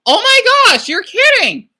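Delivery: high, loud, eager questions; gushing with excitement, voice jumping up; excited. Real, not acted. Hearing a viewer has a Ukrainian friend, excited. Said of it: "I get a little bit excited".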